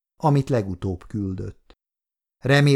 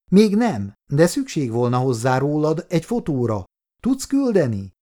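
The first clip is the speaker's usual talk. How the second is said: higher, pressing woman's voice; lightly voiced sister, insistent; urgent sister; 5 semitones higher than usual. A narrator's reading. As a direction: hurry him along, higher. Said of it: "kérdezte sürgetően az asszony".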